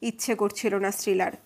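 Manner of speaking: ordinary delivery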